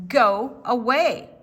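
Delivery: loud, slow gruff command; gruff go away. Acted. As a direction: shoo the cat off, slowly and firmly. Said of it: "said the man".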